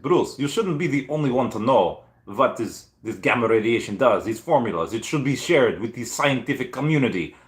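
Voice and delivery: put-on Russian accent, pitch lifted at times. Assistant urging Bruce to share the formulas, mock Russian accent. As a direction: do Igor with a hammed-up Russian accent. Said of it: "I guess I'll do a Russian accent".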